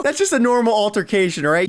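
usual speaking voice

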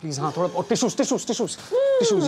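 high, frantic pleading; flustered panic. Acted. Begging Sameera to stop crying in the bar, flustered. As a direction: scramble to stop her crying. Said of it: "Please stop crying".

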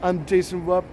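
lightly mimicked vlogger's opening; a hint of another streamer's intro voice; imitated vlogger intro. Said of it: "how you start your intro".

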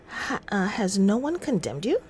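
ordinary talk, nothing marked

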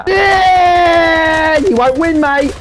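long, high-pitched yell; triumphant shouting. Real, not acted. Gamer taunting a losing opponent, triumphant shouting. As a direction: holler it in the rival's face. Screams at opponent during play.

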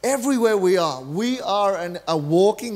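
ordinary talk

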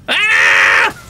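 high, loud yell of frustration; frustrated shout. Real, not acted. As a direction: yell it out at the game. Fails level, shouts.

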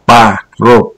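pa, rô said slowly, syllable by syllable, unstressed o as a; syllable demo. Shown as a demonstration. A commentator teaching viewers the Russian vowel rule syllable by syllable. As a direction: spell the sounds out: pa, then rô. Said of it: "tức là".

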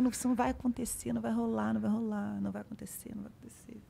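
repeating to herself, fading from soft to a bare whisper; fading self-talk. Retold or imitated. Calming herself atop the tower, fading into a whisper.

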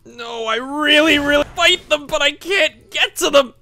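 high-pitched frustrated outburst, building to a yell; aggravated. Real, not acted. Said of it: "It's so aggravating".

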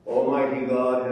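usual speaking voice

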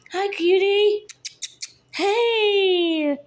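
high sing-song kitten greeting. Real, not acted. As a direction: coo up high at a tiny kitten.

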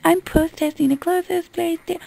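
high-pitched mimicry of a protester; mock protester. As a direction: imitate a whining complainer up high. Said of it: "and then go".

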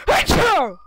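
sudden loud sneeze. Real, not acted. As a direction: sneeze hard.

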